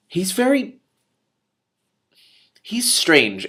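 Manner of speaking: ordinary delivery, nothing marked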